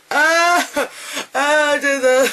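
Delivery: high, loud pained outcry; re-enacted horror. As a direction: recoil aloud in mock agony. Re-enacting disgusted reaction.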